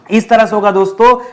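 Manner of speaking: normal talking voice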